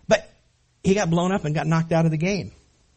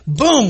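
imitated boom; 4 semitones higher, 8 dB louder than usual. Imitating another tackle hit.

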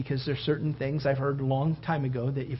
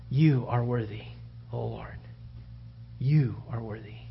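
worshipful hush; 5 semitones lower, 5 dB quieter than usual. Retold or imitated. Voicing worship at the throne.

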